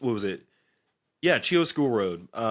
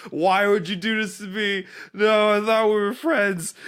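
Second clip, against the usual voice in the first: high, raised-voice horror movie plea; retold scream; 9 semitones higher, 5 dB louder than usual. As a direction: cry out a betrayed plea, imitating a screaming victim. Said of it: "just screaming".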